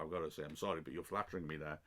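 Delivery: usual speaking voice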